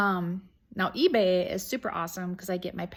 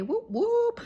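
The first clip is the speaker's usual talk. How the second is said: squeaky high whoop; playful cheer. Real, not acted. Greeting her viewers with a high playful cheer.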